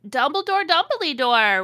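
high, loud call for someone; yelling. A narrator's reading. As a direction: holler the name in a hammed-up yell.

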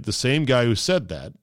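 ordinary delivery

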